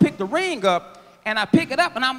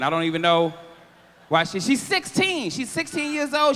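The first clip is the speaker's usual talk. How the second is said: ordinary talk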